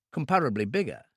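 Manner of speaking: usual speaking voice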